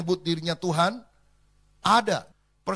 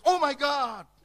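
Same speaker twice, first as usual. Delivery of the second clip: high startled cry of surprise; 10 semitones higher, 4 dB louder, much slower than usual. Retold or imitated. Thomas shocked when Jesus enters, high cry. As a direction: blurt it out, shocked. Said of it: "Kalau dia kaget".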